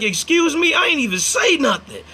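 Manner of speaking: high, incredulous "excuse me?"; retold indignant surprise. Retelling the clerk's accusation, high indignant surprise. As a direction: replay the shock of being accused.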